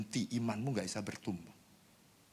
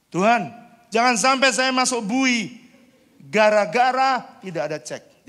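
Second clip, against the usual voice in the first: much higher and louder, crying out to God; desperate prayer; 14 semitones higher, 14 dB louder than usual. Retold or imitated. Pastor cries out to God not to be jailed, high and loud. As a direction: plead loudly, slowing on the last words.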